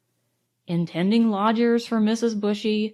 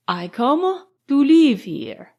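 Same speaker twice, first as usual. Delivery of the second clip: put-on slow, louder, higher foreign-accented English; careful foreign accent; 4 semitones higher, 5 dB louder, slower than usual. A narrator's reading. Count explaining himself to a new neighbour, careful foreign accent. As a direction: pick out each word in a foreign accent. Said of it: "in careful English with an accent".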